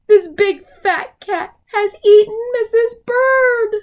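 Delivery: high, loud anguished cry. Acted. Mister Bird believing the cat ate her, anguished cry. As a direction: wail the loss in high despair. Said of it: "he cried".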